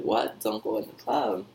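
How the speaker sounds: low, soft doubtful echo; puzzled, dropped-voice repeat; questioning the warning. Acted. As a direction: query the warning back under your breath. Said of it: "You're like".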